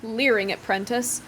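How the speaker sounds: usual speaking voice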